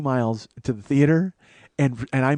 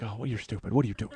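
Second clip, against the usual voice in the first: much softer self-reproach; scolding himself; 3 semitones lower, 8 dB quieter, faster than usual. Retold or imitated. Man alone in his room, soft self-scolding. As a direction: mutter it at your own stupidity. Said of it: "I'm like".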